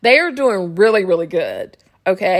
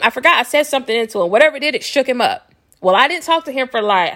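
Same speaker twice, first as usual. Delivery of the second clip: normal talking voice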